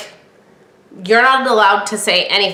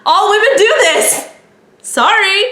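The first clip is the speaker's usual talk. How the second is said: high laughing aside; high, laughing confession; 9 semitones higher than usual. Real, not acted. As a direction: laugh it out, higher.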